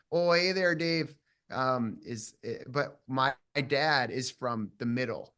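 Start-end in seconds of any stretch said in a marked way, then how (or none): 0.1-1.1 bright, high, loud Minnesota "Oh, hey there, Dave"; chirpy Minnesota greeting; southern Minnesota accent. Shown as a demonstration. Actor demoing the southern Minnesota accent, loud and high. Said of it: "so it's a little flatter down there".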